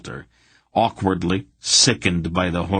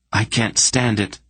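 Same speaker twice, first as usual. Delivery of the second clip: silent rage; 5 semitones higher than usual. A narrator's reading. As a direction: let the rage burst out, held back. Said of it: "Geryon raged silently".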